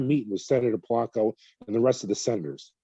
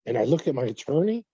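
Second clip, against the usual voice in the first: ordinary delivery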